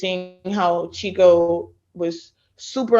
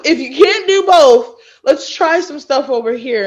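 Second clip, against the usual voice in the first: higher, louder coach laying out the plan; coach's voice; 7 semitones higher, 5 dB louder than usual. Retold or imitated. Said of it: "he was like".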